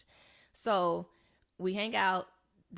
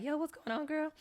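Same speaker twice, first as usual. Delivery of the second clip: higher-pitched take on a friend; imitated homeboy greeting; 8 semitones higher than usual. Homeboy asks her what's up, imitated in a higher voice.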